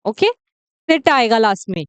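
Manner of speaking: ordinary talk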